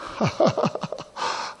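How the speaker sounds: soft, breath-heavy chuckle; self-conscious laugh. Real, not acted. Preacher admitting the sermon hit himself, soft rueful laugh. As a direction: laugh quietly at your own confession.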